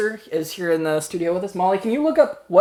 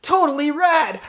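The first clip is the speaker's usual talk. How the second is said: surfer dude excited; 8 semitones higher, much slower than usual. Acted. Dinosaur mascot excited.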